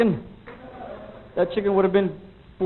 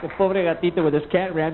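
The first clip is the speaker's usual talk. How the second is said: ordinary talk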